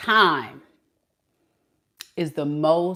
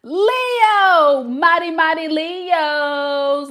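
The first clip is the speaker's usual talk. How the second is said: high, loud, slow call-out; hype announcer; 11 semitones higher, 5 dB louder, much slower than usual. Acted. Welcoming Leo viewers into the live, hyped. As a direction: announce the Leos like royalty.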